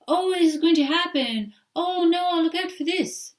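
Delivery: high, loud alarmed fretting; frazzled worrying. Retold or imitated. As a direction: fret like a panicky adult. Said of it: "Everyone starts talking like that".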